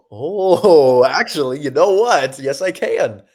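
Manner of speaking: loud, drawn-out dawning "oh"; acted sudden realization. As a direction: play up the idea just striking you. Acts out his sudden realization.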